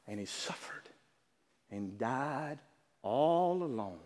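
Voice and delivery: solemn, soft ending; hushed. Acted. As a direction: drop to a near hush. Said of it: "preacher's hushed emphasis".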